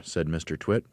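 ordinary delivery

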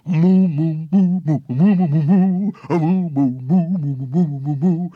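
loud sung-along cow moos; loud mooing. A narrator's reading. Cows join the music, mooing loudly. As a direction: moo like happy cows.

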